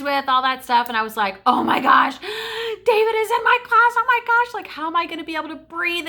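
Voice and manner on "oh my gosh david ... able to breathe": high, loud gasping schoolgirl freak-out; giddy panic. Retold or imitated. Girl spotting her crush in class, giddy panic. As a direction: relive the shock, squealed high and loud.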